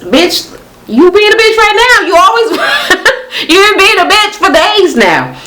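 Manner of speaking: high-pitched, incredulous clapback breaking into laughter; exasperated retort. Retold or imitated. Friend answering Tanya about being a bitch, exasperated retort.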